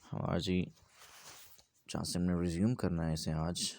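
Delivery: usual speaking voice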